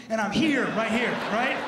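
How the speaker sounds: normal talking voice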